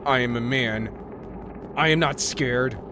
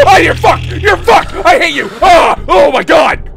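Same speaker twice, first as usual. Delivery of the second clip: high shrieking swears in fright; panicked yelling; terrified screaming; 12 dB louder than usual. Real, not acted. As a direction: scream in real terror. Jump scare by little girl.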